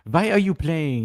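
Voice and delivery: put-on high, loud French-accented question; mock Evra in a French accent; French accent, half-hearted impression of Evra. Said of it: "with his French accent".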